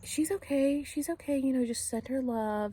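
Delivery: high, gentle, sing-song reassurance; high soothing voice. Retold or imitated. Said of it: "at first she was saying".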